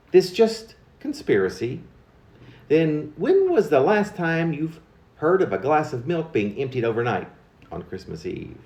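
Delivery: usual speaking voice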